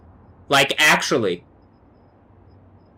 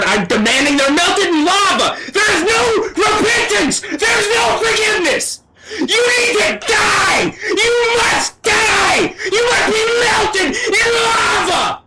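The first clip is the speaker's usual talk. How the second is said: screamed much higher, slow and breaking; furious tearful scream, far higher than speech; real crying shout. Real, not acted. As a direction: wail the curse out, sobbing with rage. Raging curse, shouting and crying.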